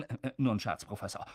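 suddenly small, soft voice; quiet, embarrassed climb-down; sheepish retraction. Acted. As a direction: backpedal meekly to the professor. Commentator backs down before professor.

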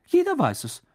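high-pitched surprised reply. A narrator's reading. Hermine to Ron about hidden Hogwarts, surprised. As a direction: answer as if astonished they don't know. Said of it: "entgegnete Hermine überrascht".

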